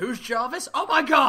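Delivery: high, incredulous outburst; mock disbelief. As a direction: echo the silly question in despair. Co-host mock despair at dad.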